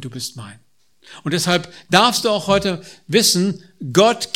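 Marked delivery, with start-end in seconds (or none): 0.0-0.6 tender promise. Acted. Tender close of quoted verse.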